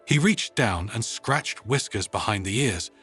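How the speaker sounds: usual speaking voice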